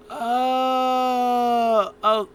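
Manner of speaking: long, high, loud drawn-out "oh"; mock reaction. Imitating a wrestler goading his rival, mock amazement. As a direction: stretch it out high in mock realization. Acting out Daniel Bryan's taunting reaction.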